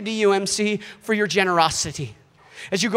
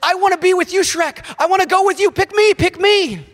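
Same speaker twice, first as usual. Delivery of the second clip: high, loud, over-eager pleading; excitable sidekick begging to come along, pitched way up; Donkey; 9 semitones higher, 5 dB louder than usual. Acted. Donkey volunteering to go with Shrek, eager high-pitched impression. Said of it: "And Donkey says".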